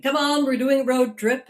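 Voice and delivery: dad gleefully announcing a night road trip; eager rallying call. Retold or imitated. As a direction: call everyone out the door, keen. Dad's sudden late-night plan.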